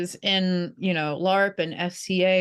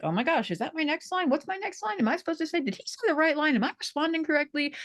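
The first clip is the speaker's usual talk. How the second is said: panicked inner monologue; 10 semitones higher, faster than usual. Retold or imitated. Actor mimicking her stage-fright thoughts, high and panicky. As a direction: fret like you've lost your next line. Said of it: "my internal monologue is like".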